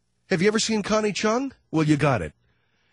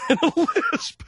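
laughing. Real, not acted. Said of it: "laughs at his own joke".

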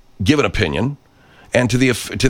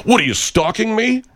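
much higher, squeaky mock outrage; mock indignant troll. Troll accuses host of stalking: much higher squeaky mock outrage. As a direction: parody his shocked accusation.

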